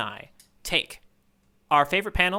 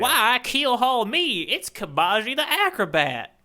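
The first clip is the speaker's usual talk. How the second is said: slow, high hammed-up pirate; 8 semitones higher, slower than usual. Host hams up a pirate introducing Kabaji, slow and high. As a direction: drag it out like a goofy pirate. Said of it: "I'll stop doing that voice".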